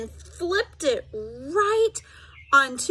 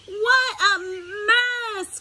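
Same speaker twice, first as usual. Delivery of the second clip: dismayed cry; cried out; 7 semitones higher than usual. A narrator's reading. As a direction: wail it in dismay. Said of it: "cried Froggy".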